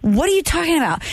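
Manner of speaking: high, fast disbelieving retort; incredulous. Retold or imitated. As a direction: throw it back in disbelief.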